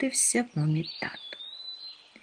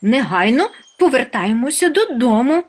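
loud, high-pitched summons; rally everyone, raised voice; urgent call; 7 semitones higher, 7 dB louder than usual. A narrator's reading. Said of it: "закликав усіх Хропусь". (гучний, наполегливий заклик)

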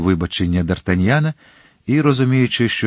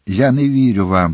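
usual speaking voice

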